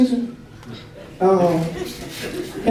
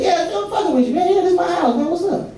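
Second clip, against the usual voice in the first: mock cheerful; 8 semitones higher than usual. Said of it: "I said".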